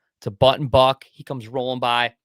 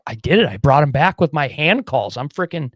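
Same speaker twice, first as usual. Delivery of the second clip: louder, pumped-up boasting; triumphant; 3 semitones higher, 9 dB louder than usual. Retold or imitated. Hunter bragging that his calls brought the buck back, louder and pumped up. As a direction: crow about the win.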